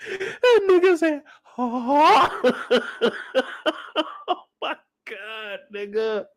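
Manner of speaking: words choked by laughter; laughing through words. Real, not acted. Vlogger retelling while still laughing.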